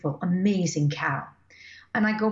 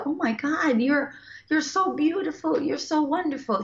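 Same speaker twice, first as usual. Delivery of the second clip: higher, gushing with delight; smitten gushing; 8 semitones higher, 3 dB louder than usual. Retold or imitated. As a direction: gush like you've just fallen in love. Falling in love with the cow.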